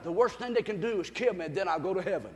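ordinary delivery

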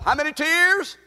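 preacher calling out to the congregation; rallying call to the crowd; call and response. Shown as a demonstration. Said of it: "I can't hear you".

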